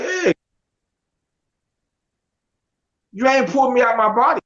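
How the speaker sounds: high startled call to a sudden visitor; startled exclamation. Retold or imitated. To the angel popping up by his bed, startled high call. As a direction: jump in surprise and call out.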